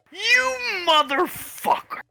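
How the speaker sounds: high shrieking curse; furious scream. Acted. Character screaming curses at Julius in fury. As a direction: scream it in rage.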